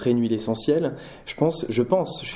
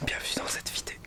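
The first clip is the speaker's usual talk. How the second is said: close-mic whisper; right in your ear; 11 dB quieter, faster than usual. Pretend. ASMR whispered intro.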